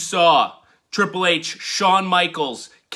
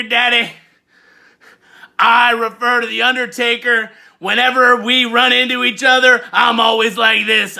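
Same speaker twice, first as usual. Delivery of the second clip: hyped, higher, shouted promo; wrestling promo voice; 5 semitones higher, 6 dB louder than usual. Acted. Wrestling fan persona hyping old wrestlers, shouted promo. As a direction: cut a promo like a pumped-up wrestler.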